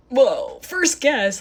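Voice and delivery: loud, high retold amazement; astonished. Woman reacting to stranger guessing her initial, amazed. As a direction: blurt out mock astonishment.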